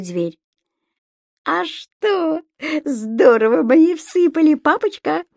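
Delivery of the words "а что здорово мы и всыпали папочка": high, gleeful little-imp boast; proud. A narrator's reading. Imp boasting to papa after landlady retreats, proud. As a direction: brag to Dad in a high voice. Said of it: "гордо заявил Субастик".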